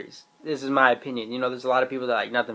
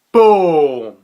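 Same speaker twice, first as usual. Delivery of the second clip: a loud, bursting "boom"; excited shout; 10 dB louder than usual. Real, not acted. Fan reacting to the Sage's reveal, loud excited shout. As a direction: explode with hype at the reveal. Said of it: "I was so excited".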